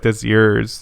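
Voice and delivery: "yours" said like "yeers"; accented "yours". Retold or imitated. Speaker recalling his own accent slip, "yours" as "yeers". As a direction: say "yours" with a narrow ee-er vowel, as yeers. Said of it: "Instead, I was like".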